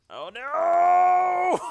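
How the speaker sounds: long high scream. Real, not acted. Gamer screaming as his hero dies. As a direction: wail as death comes. About to die in game.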